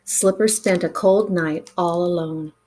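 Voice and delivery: plain reading voice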